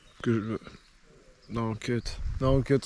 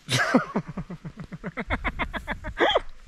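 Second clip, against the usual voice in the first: laughing; 9 semitones higher, 5 dB quieter than usual. Real, not acted. Giggling fit while telling prank.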